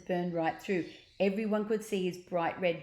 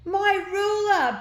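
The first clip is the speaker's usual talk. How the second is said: very high, loud, slow bellow; 14 semitones higher, 11 dB louder, slower than usual. A narrator's reading. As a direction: bellow for your ruler. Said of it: "bellowed Rath".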